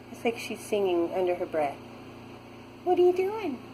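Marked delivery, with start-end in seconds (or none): 2.8-3.6 cooing at the cat. Real, not acted. Talking to her cat Zoe, high cooing baby talk.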